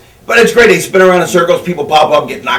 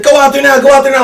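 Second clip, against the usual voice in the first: urgent mock panic; 7 semitones higher, faster than usual. Panicking boss urging him out.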